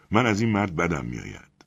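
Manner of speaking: usual speaking voice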